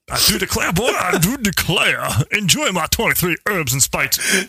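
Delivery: slow Southern rooster drawl; slow, hammy Southern cartoon rooster accent; Southern accent, slow and theatrical like a cartoon colonel; Foghorn Leghorn. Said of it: "Foghorn Leghorn doing Colonel Sanders".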